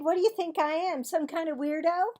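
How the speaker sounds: high, loud offended no; indignant girl. Acted. Cassie to Marvin, high and indignant. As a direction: scoff at the very idea.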